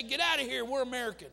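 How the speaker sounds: loud mocking of a hostile churchgoer; mock rude dismissal. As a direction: throw it out like a scornful neighbour, loud. Mocking what churchgoers tell foreigners.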